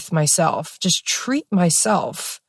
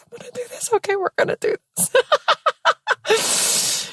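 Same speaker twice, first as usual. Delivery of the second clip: much higher, soft, tight little squeak; squeaky nervous pep talk; 3 dB quieter than usual. Retold or imitated. Psyching herself up in the tub, high squeaky nerves. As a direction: go up high and small, nerves squeezing through.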